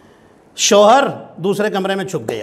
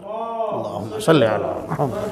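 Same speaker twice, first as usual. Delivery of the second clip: slow devotional recitation; 6 semitones lower, 8 dB quieter, slower than usual. Real, not acted. Congregation invited to recite salawat.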